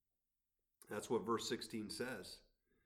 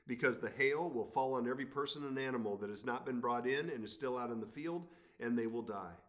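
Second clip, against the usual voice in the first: lifted, measured Bible reading; scripture reading; 4 semitones higher, 6 dB louder than usual. Acted. Pastor reads Exodus to the congregation, raised reading voice. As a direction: read God's words to Pharaoh with weight.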